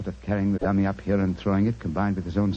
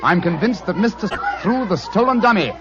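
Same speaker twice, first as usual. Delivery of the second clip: louder, rallying call over the crowd; 11 semitones higher, 7 dB louder than usual. Acted. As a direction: shout over the bonfire noise. Holmes calls over bonfire crowd.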